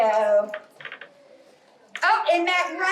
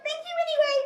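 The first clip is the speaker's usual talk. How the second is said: much higher, quick squeaky squirrel; faster than usual. Acted. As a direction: chirp it fast like a tiny squirrel. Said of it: "And Squirrel says".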